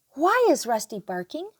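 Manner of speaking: plain reading voice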